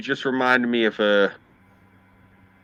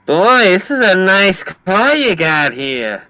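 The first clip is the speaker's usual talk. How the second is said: high, loud, drawn-out female voice; gushing woman impression; 7 semitones higher, 5 dB louder, slower than usual. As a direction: gush slowly in a high woman's voice. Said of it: "she goes like this to me".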